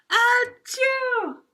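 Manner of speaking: high, hammed-up sneeze "Achoo!"; a big silly storybook sneeze; mock sneeze. Teacher acting out an ant sneezing from pepper, high hammed-up sneeze.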